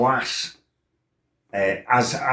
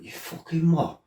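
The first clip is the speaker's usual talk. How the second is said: sharp, high, incredulous snap; outraged retort; 8 semitones higher, 4 dB quieter than usual. Retold or imitated. Told his girlfriend was insulted, snapping back high and outraged. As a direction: fire it back in disbelief. Hears girlfriend called a slag.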